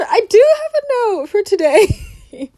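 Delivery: high, loud and slow through a laugh; amused at finding the note; real laughter breaking through. Real, not acted.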